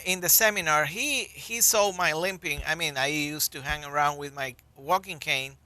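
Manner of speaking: ordinary talk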